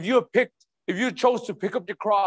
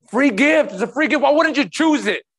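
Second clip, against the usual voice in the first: loud preaching outburst, pitched up; impassioned urging; 4 semitones higher, 6 dB louder than usual. Real, not acted. Preacher urging the congregation to choose the gift, loud and impassioned. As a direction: burst out, pleading with them to take it.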